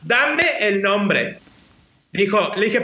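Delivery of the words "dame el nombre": drawn-out, insistent demand; retold angry mother. Mother insists on the name despite son's protest, angry. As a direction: stretch each word slowly as mum's order. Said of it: "se enojó bastante".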